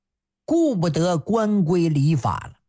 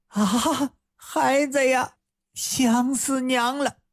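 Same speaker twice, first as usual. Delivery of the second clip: higher, mother overcome at seeing her son; mother's joyful cry; 6 semitones higher than usual. Acted. Mother greeting her lost son, higher joyful voice. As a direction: call out to your child with relief.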